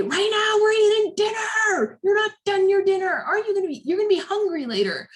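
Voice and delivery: high, loud exasperated parent shout; worked-up scolding. Shown as a demonstration. Therapist demonstrates what not to say at dinner, frantic scolding. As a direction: scold in a shrieky, rising-pitched rush. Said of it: "You don't, don't say".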